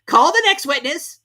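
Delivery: high, loud summons; high, loud king; king calling out. A narrator's reading. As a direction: call across the court. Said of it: "said the king".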